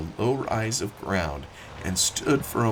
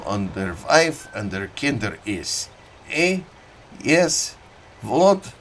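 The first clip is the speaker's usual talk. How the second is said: put-on thick German-accented old farmer, reminiscing; a proud old German immigrant telling his war story; 2 semitones higher, 5 dB louder than usual. Old German farmer telling Annixter about Gravelotte, in a thick German accent.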